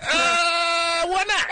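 high, airy why not; mock offhand shrug. To the Yale panel, a high carefree shrug. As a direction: toss it off in a high voice. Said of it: "And I was like".

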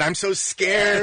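high, slow, drawn-out mock fright; mock scared. Cast member quoting a famous scene, mock scared. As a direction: stretch out SCARED in pretend dread. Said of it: "somebody sent something back and said".

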